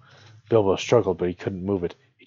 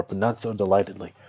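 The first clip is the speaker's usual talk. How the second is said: usual speaking voice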